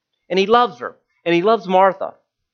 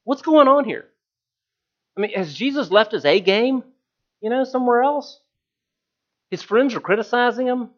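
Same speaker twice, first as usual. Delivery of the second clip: wondering disciples; 6 semitones higher than usual. Retold or imitated. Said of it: "they're wondering".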